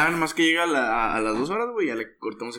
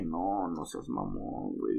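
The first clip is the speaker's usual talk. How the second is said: muttering to himself; 11 semitones lower, 7 dB quieter, slower than usual. Retold or imitated.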